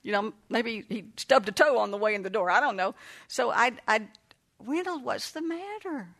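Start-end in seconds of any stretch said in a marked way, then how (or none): 4.6-6.1 soft, slow, higher and hushed; gentle concern. Retold or imitated. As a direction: ease the question to a crying child. Grandma asks crying grandson gently.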